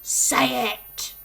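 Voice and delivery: loud, high bellow. Acted. Headmistress bellows at Rupert to say it. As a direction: bellow the command. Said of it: "bellowed the Trunchbull".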